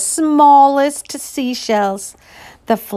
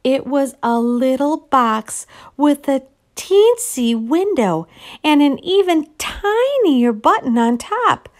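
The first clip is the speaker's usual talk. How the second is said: ordinary delivery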